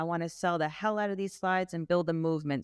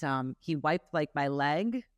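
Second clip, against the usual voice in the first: ordinary delivery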